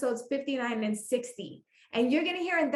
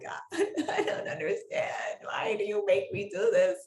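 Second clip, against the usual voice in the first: mock sobbing plea to God; imitated crying; 4 semitones lower than usual. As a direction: wail it half-laughing, as a re-enacted breakdown. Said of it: "crying like".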